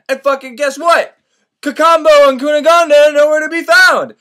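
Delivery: loud, high storyteller's outburst; exasperated exclamation. Real, not acted. Storyteller reveals the missing lovers, loudly exasperated.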